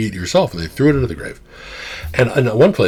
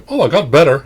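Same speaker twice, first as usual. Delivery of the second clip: higher, breezy "I got better"; lightly voiced friend shrugging off death; casual dead friend; 7 semitones higher, 8 dB louder than usual. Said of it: "They'll say something like".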